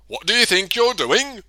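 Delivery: angry. Acted. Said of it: "he thundered".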